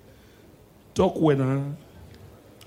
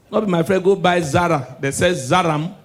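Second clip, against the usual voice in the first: usual speaking voice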